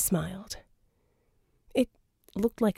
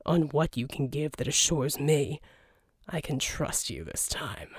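dry low voice; 5 semitones lower, 4 dB quieter than usual. Acted. Jack voiced in the story.

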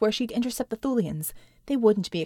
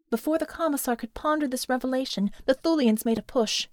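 usual speaking voice

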